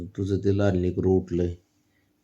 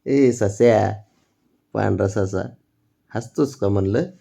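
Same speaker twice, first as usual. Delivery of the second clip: louder, playful pet-name teasing; playful calling; 2 semitones higher, 7 dB louder than usual. Real, not acted. Parent teasing the baby with pet names.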